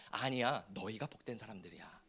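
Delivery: soft, gentle reassurance from God; quiet reassuring voice of God; God's tender voice. Acted. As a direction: say it softly like a loving father. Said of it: "하나님 말씀하시는 거예요".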